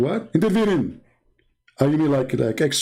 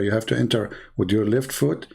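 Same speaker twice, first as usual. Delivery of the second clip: usual speaking voice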